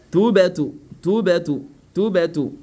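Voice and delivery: "Tubatu" with an echo vowel after the b; qalqala bounce. Shown as a demonstration. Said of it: "Faut dire quoi?". (rebond sur le b de "Tubatu")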